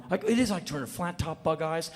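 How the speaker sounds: usual speaking voice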